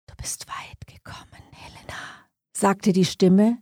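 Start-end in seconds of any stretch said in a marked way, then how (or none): 0.1-2.3 eerie whisper right at the ear; sinister, barely audible whisper. A narrator's reading. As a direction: whisper it very softly, all breath. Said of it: "nun ein Flüstern direkt neben ihrem Ohr".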